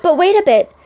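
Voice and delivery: high, loud cry of alarm; oysters crying out. Acted. Oysters begging the walrus to wait, high loud cry. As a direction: call out to stop the walrus. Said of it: "the oysters cried".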